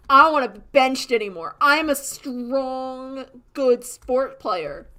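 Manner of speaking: loud and high, slowing on the boast; mock defiant declaration. Benched player standing up to the manager, loud mock defiance. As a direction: declare it big, stretching out the last words. Acts kid confronting the team manager.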